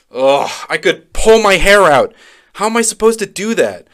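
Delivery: high, loud frustrated outburst; exasperated. Acted. Child must give Eli bad news, exasperated and high.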